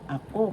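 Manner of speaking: "ako" with weight on KO; accent on second syllable. Shown as a demonstration. Teacher reviewing how to pronounce "ako", stressed on KO. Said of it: "The accent is in the second syllable".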